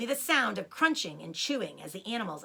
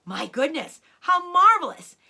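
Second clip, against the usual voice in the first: louder, higher delighted exclamation; thrilled, louder cry; 7 semitones higher, 10 dB louder than usual. A narrator's reading. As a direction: gush with delight. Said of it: "they cried".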